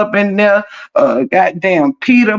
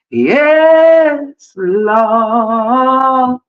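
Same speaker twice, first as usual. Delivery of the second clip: preacher's praise; 4 semitones higher than usual. Pretend. Church-style exclamation.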